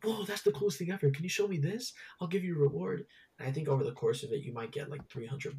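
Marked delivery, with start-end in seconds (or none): 0.0-3.1 put-on higher, eager kid voice; excited kid. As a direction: gush like a thrilled little boy. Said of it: "he gets so excited".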